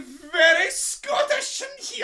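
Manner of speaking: hammed-up broad Scottish accent, high. Co-host warning of what is coming, broad Scottish. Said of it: "about to get hella Scottish".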